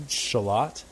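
"Shallot" with stress on the second syllable; mom's way of saying "Shallot", sha-LOT; mom's pronunciation. Retold or imitated. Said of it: "which your mom calls".

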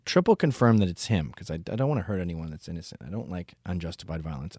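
usual speaking voice

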